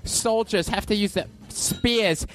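high, slow ranting-comic impression; Bill Burr. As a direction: stretch it out high, like a comic mid-rant. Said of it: "a little piece of Bill Burr".

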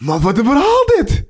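high, incredulous exclamation; astonished, voice leaping up on verhaal; real amazement. Real, not acted. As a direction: marvel at an unbelievable story. Still floored by the anecdote.

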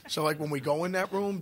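ordinary talk

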